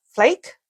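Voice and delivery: 'flake' said slowly with the A of 'ape'; long A. Shown as a demonstration. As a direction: say 'flake' as FLAYK. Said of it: "it's A as in ape".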